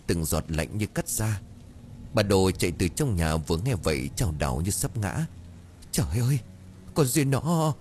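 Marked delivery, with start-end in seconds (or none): 5.9-7.7 high, broken stammer before sobbing; a hint of a sob catching the words; stammering in grief. A narrator's reading. Wife hearing Duyên drowned, stammering in grief. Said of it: "Bà Đồ lắp bắp rồi buột miệng khóc nấc".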